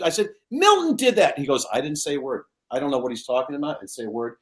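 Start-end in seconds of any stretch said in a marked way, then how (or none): 0.5-1.4 indignant protest. Retold or imitated. Kid protesting. 1.7-4.3 softer, fading mock-innocent denial; feigned innocence. Milton denying to Lucy, soft mock innocence.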